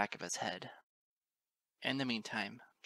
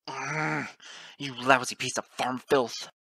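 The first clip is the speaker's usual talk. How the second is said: loud, slow enraged insult; raving; 3 semitones higher, 9 dB louder, slower than usual. A narrator's reading. Father pinned by farm stallion, loud furious raving. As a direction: rave furiously at the one pinning you. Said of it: "Straight Edge is rambling, raving".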